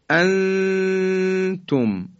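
«انتم» with the noon drawn out in a long nasal hum; very slow «انتم», long ghunna before the ت; ikhfa. Shown as a demonstration. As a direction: hold the hidden n of «انتم» on one high, level note.

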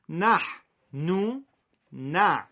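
نحن stretched very slow, Arabic not Persian; correct Arabic. Shown as a demonstration. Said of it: "یه خود فارسی شد". (نحن با تلفظ عربی)

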